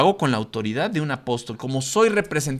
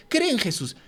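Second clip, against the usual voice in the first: mock sign-waver; 7 semitones higher, 3 dB quieter than usual. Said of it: "evangelizar así con tu cartulina".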